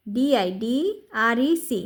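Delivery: usual speaking voice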